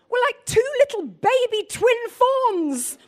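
giggling as you read the line aloud; laughing. Acted. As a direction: savour the compliment while laughing. Gushing over the Bible's compliment.

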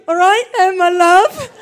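loud, teasing husband voice; husband mocking wife locked behind door, loud and teasing; taunting husband. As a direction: tease her sweetly through the door. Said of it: "he's taunting me".

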